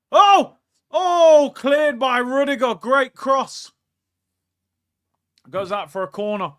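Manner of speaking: loud, high match reaction; live football excitement; excited reaction. Real, not acted. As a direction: gasp along with the chance.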